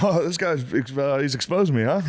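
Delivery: high mock sheepish voice. Imitating guy caught out.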